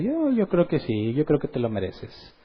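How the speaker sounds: lightly voiced tempter, sweet and sly; Satan's soft persuasion. Preacher voices Satan coaxing a believer, soft and sly. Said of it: "de una manera sua-, suave, sutil".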